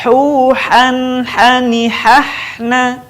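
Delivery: "Hu, han" chanted long with a held n; swing and hold. Shown as a demonstration. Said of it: "ayu-ayun mana tahan". ("Hu, han" diayun dan ditahan)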